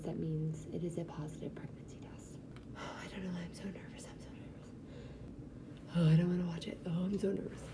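breathless, shaky whisper, barely voiced; nervous whisper. Real, not acted. Alone in her car watching the test, nervous whisper. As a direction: hush it out as if afraid to jinx it.

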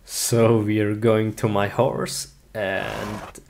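ordinary talk, nothing marked

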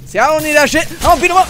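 shouted. Acted. Refusing the offered gun with a triumphant shout. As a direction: yell it out, defiant.

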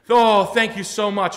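very high, loud, slow gushing thanks; the customer thanking her salesman, in a very high loud gush. Retold or imitated. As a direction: overdo the gratitude.

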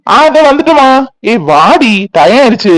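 high, loud call from another room; calling back loudly. Acted. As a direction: shout back that you're coming. Husband calls back hurriedly. (உரக்க பதில் குரல்)

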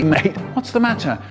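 ordinary talk

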